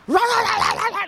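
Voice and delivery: high-pitched rattled-off "rah-rah-rah"; screaming gibberish. Retold or imitated. Storyteller imitating a delirious woman's screamed gibberish. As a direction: mimic a woman babbling in a high screech. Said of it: "she was speaking and screaming gibberish".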